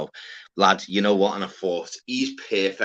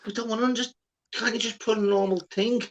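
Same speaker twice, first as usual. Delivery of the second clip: higher, incredulous plea; exasperated plea; 9 semitones higher, faster than usual. Retold or imitated. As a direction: beg him to write normally. Retold pleading for normal writing.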